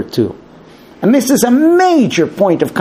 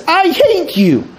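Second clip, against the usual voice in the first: wife in a fight; 14 semitones higher, slower than usual. Retold or imitated. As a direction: fling it at him, much higher. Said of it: "she says".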